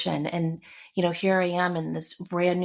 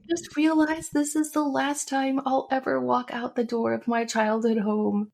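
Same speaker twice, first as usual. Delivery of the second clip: high, wailing imitation of a crying daughter; mock boo-hooing; 7 semitones higher than usual. Daughter comes back to the door after leaving, mock boo-hooing. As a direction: imitate a tearful wail, pitched up. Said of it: "boo-hooing".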